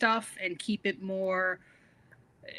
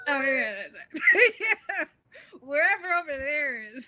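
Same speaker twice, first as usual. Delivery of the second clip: laughing; 6 semitones higher, 3 dB louder than usual. Real, not acted. Laughing at far-off move.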